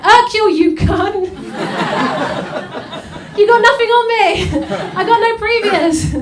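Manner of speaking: loud, high, hammed-up thug shouting back; mock furious yell. Caught criminal yelling at Poirot, mock furious and loud. GBH criminal yells at detective.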